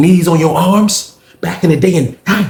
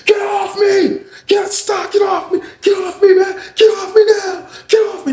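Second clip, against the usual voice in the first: retold screaming protest. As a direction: mimic someone screaming while held down. Sean screams as police come.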